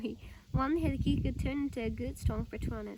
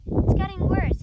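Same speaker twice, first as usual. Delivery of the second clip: ordinary delivery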